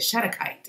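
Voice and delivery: Shatukite with stress on the first syllable; alternative pronunciation. Shown as a demonstration. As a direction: try Shatukite as SHAT-uh-kite. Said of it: "It's either Shatukite or Shatukite".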